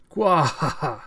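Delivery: short, loud hammed-up evil laugh; villain cackle. Escaping cyborg head gloating, evil cackle.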